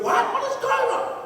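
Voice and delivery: high-pitched, taken-aback question; startled disbelief. Retold or imitated. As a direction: voice leaping up in mock astonishment. Rich man startled by homeless guest.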